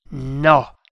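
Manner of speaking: loud, firm refusal; unshakable firmness. Acted. The prisoner refuses to talk, unshakably firm. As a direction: refuse outright, giving no ground. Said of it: "esclamò l'indiano con fermezza incrollabile".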